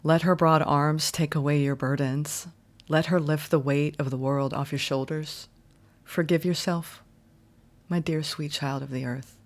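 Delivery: soft, tender oracle reading voice, trailing ends; channeled card message. Acted. Reader channels Star Mother's message to viewers, tender and hushed. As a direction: soothe the viewer like a mother comforting her child.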